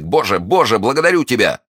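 high, emotional outburst; relieved cry. Acted. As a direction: cry it out in gratitude. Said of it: "вскричал молодой человек".

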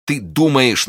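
high, stricken questions; horrified, near sobs. Acted. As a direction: ask in dawning horror. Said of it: "голос его прервался от рыданий".

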